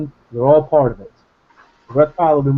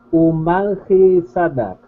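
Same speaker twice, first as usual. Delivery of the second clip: slow reading; 2 semitones higher, much slower than usual. Shown as a demonstration. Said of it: "I see what it says".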